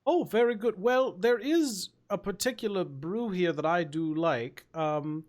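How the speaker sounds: genteel ogre with a high, lilting opening; courteous creature voice, delighted to be asked; polite ogre, pleased. Acted. Ogre recommending a brew to a newcomer, politely pleased.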